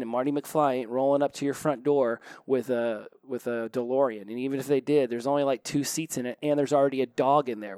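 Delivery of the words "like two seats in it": ordinary delivery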